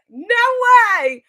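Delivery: loud, high-pitched disbelief; amazed exclamation. Retold or imitated. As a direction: re-enact being stunned and thrilled. Retold amazed reply to fan.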